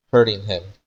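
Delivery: ordinary delivery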